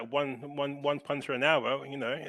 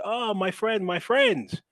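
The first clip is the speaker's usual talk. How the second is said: put-on a higher-pitched touch of the old stranger; lightly voiced old guy; 8 semitones higher, 4 dB louder, slower than usual. Storyteller quoting an old guy in Athens, lightly voiced. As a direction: lightly imitate an old man hailing a visitor. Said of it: "was this old guy with a walking stick".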